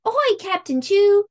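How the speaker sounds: put-on high, loud pirate girl's call; hollering. A narrator's reading. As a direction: holler it across the deck. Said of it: "Emma hollered".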